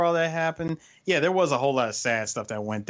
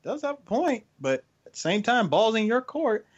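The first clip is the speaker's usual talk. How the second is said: smug sidekick; 9 semitones higher than usual. Retold or imitated. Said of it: "He looked at him like".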